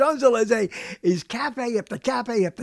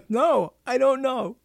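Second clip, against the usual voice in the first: normal talking voice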